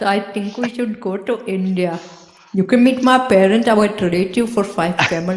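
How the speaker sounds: a light put-on Indian accent. Joking to a friend about meeting parents, light Indian accent. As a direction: play a hint of an Indian accent. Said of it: "I love doing accents".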